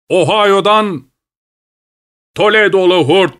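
high-pitched call across a distance. A narrator's reading. Toledo man turns back to his companion in the fog, calling out. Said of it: "sisin arasından ötekine seslendi".

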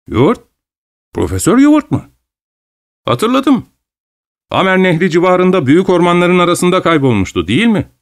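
high, startled remembering; suddenly remember the lost professor; surprised recall. Acted. Traveller recalls the lost Professor Heward with surprise.

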